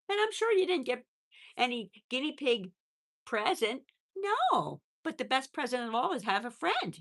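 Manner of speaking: high cooing to a small animal; pet talk. Real, not acted. Owner talks to guinea pig about presents, cooing.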